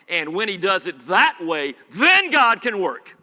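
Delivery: loud, slow swelling climax; preaching climax. Acted. Preacher building to God's work, loud and slowed. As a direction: lift the promise over the room.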